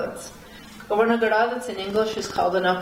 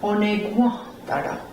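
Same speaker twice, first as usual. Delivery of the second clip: "Onegwa dara" with gwa stressed, dara dropped; confirming "Onegwa dara" with the strong wa; upstress on "wa". Shown as a demonstration. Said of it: "On the wa".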